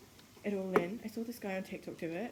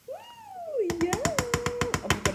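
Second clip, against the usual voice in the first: excited squeal; 8 dB louder, much slower than usual. Real, not acted. Excited squeal over cream.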